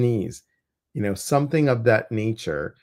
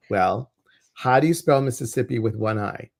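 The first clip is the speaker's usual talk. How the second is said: normal talking voice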